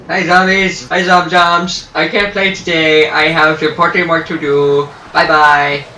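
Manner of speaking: loud singsong taunt at zombies, dragged out; mock sweet greeting. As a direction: greet the zombies like playmates, then wave them off. Gamer talks to zombies mockingly.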